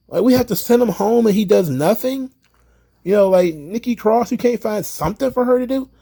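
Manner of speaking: high, incredulous questions; real outrage at the office; indignant disbelief. Real, not acted. Indignant about released wrestlers.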